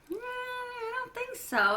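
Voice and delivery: high, slow, singsong no; playful doubt. Real, not acted. Reader telling listening kids Janie did not appreciate it, playful high doubt.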